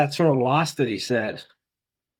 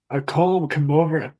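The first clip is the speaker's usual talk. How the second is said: ordinary talk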